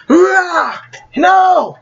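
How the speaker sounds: high, loud cry of despair; anguished shout. Acted. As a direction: scream it as he falls. Villain falls, hero cries out.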